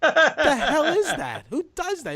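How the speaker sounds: high, laughing through it; cracking up in disbelief. Real, not acted. Laughing at the staring fan.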